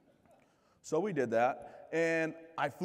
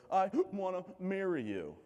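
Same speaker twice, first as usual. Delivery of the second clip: higher, slow teary plea; mock tearful; 7 semitones higher, slower than usual. Girlfriend proposing through tears at the airport, mock tearful. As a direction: ham up her tearful proposal. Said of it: "in between her sighs and her sobs".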